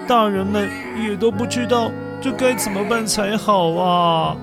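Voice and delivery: ordinary delivery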